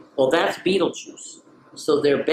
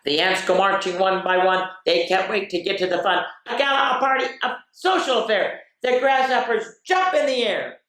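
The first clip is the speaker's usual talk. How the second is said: bright, lively storybook voice; excited picture-book reading; reading to children; 5 semitones higher, 4 dB louder than usual. Acted. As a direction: read it like a party is coming.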